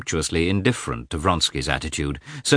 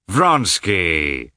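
glad exclamation; 3 dB louder than usual. A narrator's reading. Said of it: "exclaimed with pleasure".

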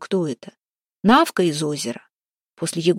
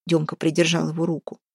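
usual speaking voice

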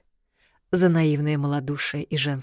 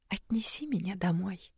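faint, breathy plea; whisper; 3 semitones higher, 10 dB quieter than usual. Acted. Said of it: "слабовольно промямлила я". (слабый шёпот)

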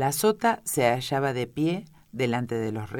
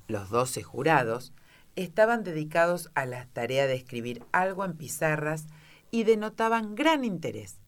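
plain reading voice

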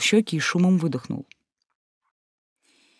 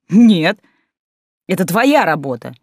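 sharp retort; 2 semitones higher, 6 dB louder, slower than usual. A narrator's reading. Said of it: "Отрезала я".

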